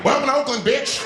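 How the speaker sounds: high, loud and in-your-face; brash shouted welcome. Acted. Oakland greeting arrivals from San Francisco, brash shout. The other side greets rudely.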